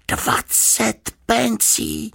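slow, high, drawn-out indignation; bitter. A narrator's reading. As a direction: spell out the sum bitterly, word by word. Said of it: "řekl hořce". (hořce, protáhle)

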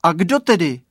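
insistent loud question; calling out question. Acted. Boy calls back into fog.

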